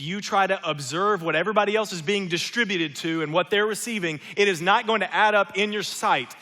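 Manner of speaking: loud, high, fervent preaching; preaching at full force. Acted. Pastor pressing home God's goodness to the congregation, loud and fervent. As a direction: drive it home to the whole church.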